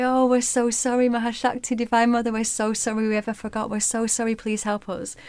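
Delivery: grovel and plead for forgiveness; desperate apology. Acted.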